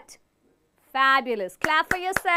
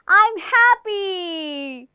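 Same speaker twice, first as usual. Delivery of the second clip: slow, beaming, higher and louder; happy; 5 semitones higher, 7 dB louder, much slower than usual. Shown as a demonstration. Teacher demonstrating a happy face to the class, beaming. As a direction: stretch out a big smiling I'm happy. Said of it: "smile and say the dialogue".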